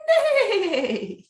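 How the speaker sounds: high, loud laughing whinny; whinny like a running horse; horse neigh. Acted. Horses in farm story.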